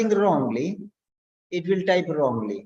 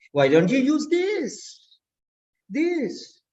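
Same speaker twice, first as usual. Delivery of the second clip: slow, high swell, then "this" glides down; falling tune on "this"; 6 semitones higher, slower than usual. Shown as a demonstration. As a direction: draw out "this" from high pitch to a fall. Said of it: "Going down".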